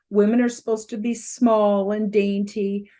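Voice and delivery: usual speaking voice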